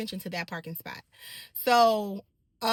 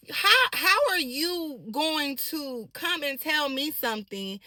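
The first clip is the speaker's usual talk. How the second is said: usual speaking voice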